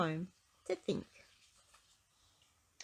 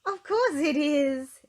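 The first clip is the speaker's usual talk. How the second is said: slow, high, much louder giggly reply; giggling; 9 semitones higher, 10 dB louder, slower than usual. Acted. Miss Giggles giggling back at Mister Happy, slow and high. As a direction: giggle it out at last. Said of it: "she giggled".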